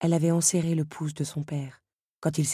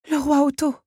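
high, loud shocked exclamation; shocked gasp; 11 semitones higher, 7 dB louder than usual. A narrator's reading. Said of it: "souffla-t-elle, sous le choc". (exclamation aiguë, sous le choc)

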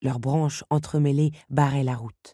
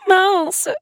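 grogné; 8 dB louder than usual. A narrator's reading. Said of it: "grogna Belle".

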